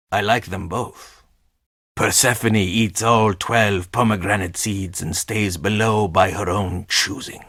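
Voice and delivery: normal talking voice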